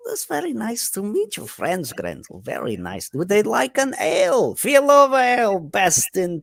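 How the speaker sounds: high, lilting character voice; jolly halfling parent. Acted. Halfling papa meeting Grendel's friends, jolly. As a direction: pitch it up like a merry ale seller.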